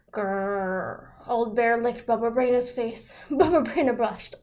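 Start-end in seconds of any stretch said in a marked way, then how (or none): 0.1-1.1 soft growl. A narrator's reading. Bear growls softly.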